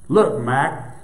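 ordinary delivery, nothing marked

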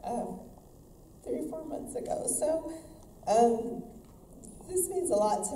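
fighting back tears as she speaks of her son; choked up. Real, not acted. Boxer tells the crowd about her son, voice breaking in tears. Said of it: "I get choked up".

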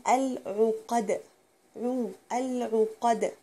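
العقد with a full rounded u on the ain. Shown as a demonstration. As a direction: round your lips well on عو. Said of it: "يعني ضمها كويس". (ضم العين جيدا)

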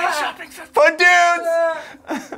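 high, slow screamed ad read; screaming ad. Pretend. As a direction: scream it like a wild ad. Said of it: "this whole one's gonna be like".

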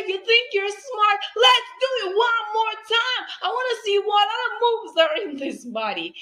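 high, loud, beaming praise; playful teacher. Retold or imitated. Teacher showing kids her silly dance move. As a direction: hype the child up. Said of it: "I say".

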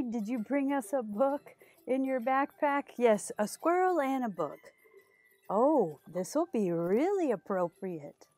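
usual speaking voice

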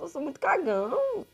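ordinary delivery

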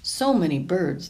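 ordinary delivery

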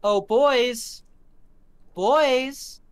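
high, loud singsong taunt; taunting call. Acted. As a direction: call out to the gang, mocking them. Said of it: "walks out and goes".

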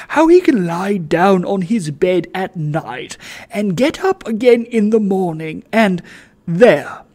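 loud, exasperated moral outrage; indignant. Acted. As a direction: scold him in disbelief. Sir Joseph's indignant outburst.

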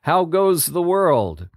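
distant watch cry. A narrator's reading. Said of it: "a cry that echoed through the woods".

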